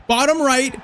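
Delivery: will the dive to be right; urgent plea. Real, not acted.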